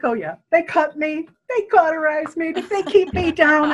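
mock-confident husband, laughing through it. Husband scoffing at the pregnancy news, laughing as he talks. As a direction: laugh it off like the husband who was sure. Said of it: "And he starts to laugh".

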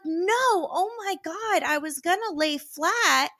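high, loud flustered denial; mortified protest. Retold or imitated. Kristen denying to doctor and Michael, high mortified protest. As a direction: insist it was all a misunderstanding.

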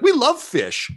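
very high, delighted crowd cry; cheering crowd. Acted. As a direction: squeal it like a happy fan.

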